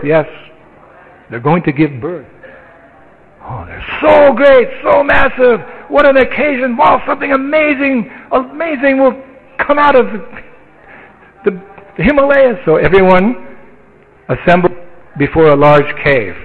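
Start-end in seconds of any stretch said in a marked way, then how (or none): 3.5-12.6 much higher, wide-eyed gushing; awed villagers. Acted. Villagers await the mountain's birth, high awed excitement. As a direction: gasp over it like a giddy crowd.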